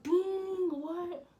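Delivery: high-pitched, drawn-out incredulous "dang, what?"; shocked audience reaction. Acted. Storyteller mimicking her viewers' reaction to the gossip, shocked. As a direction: act out the viewers' stunned disbelief. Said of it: "So I want y'all to be like".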